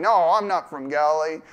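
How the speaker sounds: loud, hammed-up fake Judean accent denial; badly faked accent. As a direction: unconvincingly put on a Judean accent. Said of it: "trying to throw that on".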